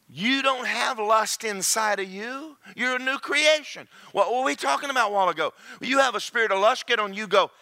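ordinary talk

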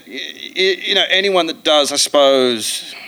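normal talking voice

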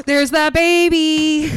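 slow, high gasping delight; delighted surprise. Real, not acted. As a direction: gasp then savour the words. Gasps at card art.

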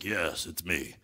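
very low, hushed pretend voice; sneaky low "it's me" of an impostor; disguised impostor son. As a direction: drop way down to pass as someone else. Son impersonates brother to father.

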